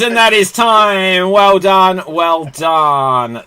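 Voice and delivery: loud, high announcer shout easing down; calling time. Real, not acted. Host ending the last turn, loud congratulations. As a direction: shout the finish, then draw out the praise.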